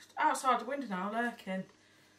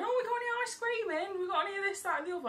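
put-on much higher pleading kid voice; kids' voice; 10 semitones higher, faster than usual. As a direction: mimic kids begging for ice cream, pitched way up. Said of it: "the kids are always going".

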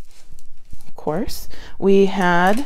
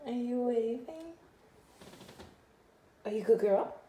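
talking to a dog; 4 semitones higher, 17 dB quieter than usual. Real, not acted. Talking to her dog Goldie.

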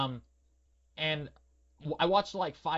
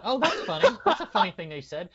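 amused inner voice; 4 semitones higher, 8 dB louder than usual. Retold or imitated. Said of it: "and I thought".

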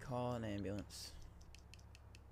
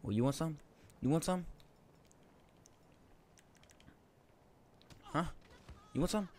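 loud, rising tough-guy taunt; 6 semitones higher, 5 dB louder than usual. Pretend. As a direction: challenge them to a fight. Taunting game characters.

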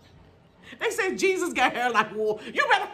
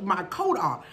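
normal talking voice

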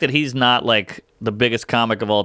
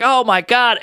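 much higher scandalized gasp; shocked host again; 11 semitones higher, 3 dB louder, slower than usual. Retold or imitated. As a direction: clutch the pearls. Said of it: "And she's like".

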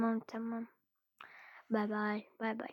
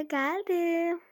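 normal talking voice

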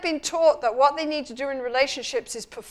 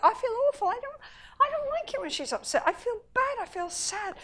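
high, plaintive voice, mostly softer; upset husband; 10 semitones higher, 4 dB quieter than usual. Retold or imitated. Husband tells the coach he feels bad, high and plaintive. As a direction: voice a helpless husband, pitched up.

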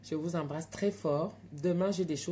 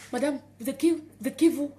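loud, higher, accusing question; retold angry challenge; 7 semitones higher, 7 dB louder than usual. Midwife challenging the mother in the nursery, loud and accusing. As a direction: demand who she is, indignant.

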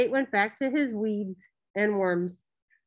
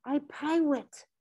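put-on small, high, slow toddler voice; tiny child's voice, held back and drawn out; quiet little boy; 4 semitones higher, 3 dB quieter than usual. A narrator's reading. Three-year-old explains himself to his mama, quiet little boy. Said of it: "Theo said very quietly".

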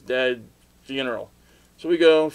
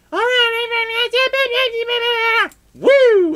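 much higher, loud rock-show whoop; hype shout; 8 dB louder, slower than usual. Retold or imitated. Friend on stage hyping the crowd, loud whooping shout. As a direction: fire up the crowd.

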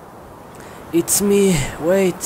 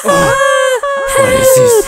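much higher and louder, a startled yell; alarmed shout; 6 dB louder than usual. Pretend. Hulk spotting his girlfriend in danger, startled shout.

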